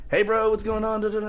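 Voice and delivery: high, bright-pitched Cali bro hello; mock California accent; Californian greeting. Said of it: "now I talk like I'm from Cali".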